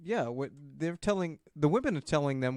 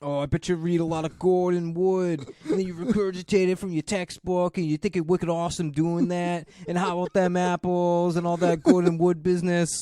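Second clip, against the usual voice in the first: a louder, hammed-up Boston rant; Boston accent; 3 semitones higher, 5 dB louder than usual. Podcaster mocks a Harvard student in a Boston accent. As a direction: taunt him in a thick mock Boston accent. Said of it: "he does a good Boston accent".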